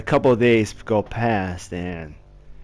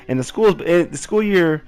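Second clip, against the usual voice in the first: normal talking voice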